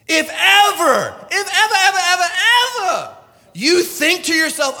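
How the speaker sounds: high, loud repeated cry; emphatic preaching shout. Real, not acted. Preacher building to emphatic peak.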